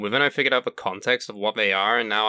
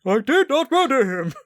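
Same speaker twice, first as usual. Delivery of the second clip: bad robot impression; 13 semitones higher, 5 dB louder than usual. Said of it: "that's an inside joke".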